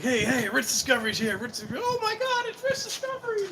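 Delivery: high, squealing excited fans spotting a celebrity; squealing fan girls. Pretend. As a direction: shriek it like giddy fans who just saw a star. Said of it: "They're like".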